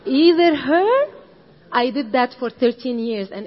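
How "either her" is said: high, loud, slow laughing burst; laughing outburst. Real, not acted. Preacher joking to the sisters, laughing high and loud. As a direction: blurt it through a laugh.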